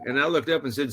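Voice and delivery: ordinary talk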